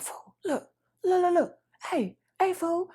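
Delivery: urgently whisper-call your cousins over; getting cousins to look at the haircut, hushed. Retold or imitated. Calling cousins in hushed tones.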